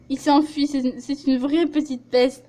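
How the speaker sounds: ordinary delivery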